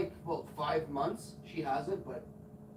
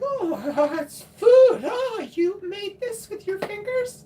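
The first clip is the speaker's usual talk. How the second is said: very high, loud, drawn-out mock gushing; squealing, slow put-on enthusiasm; feigned delight; 14 dB louder, slower than usual. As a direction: overdo the horrified delight at being served food. Feigns delight at neighbour's homemade food.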